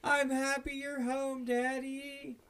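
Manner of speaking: put-on high, slow little kid voice; childlike greeting. Kid greeting dad coming home, high and slow.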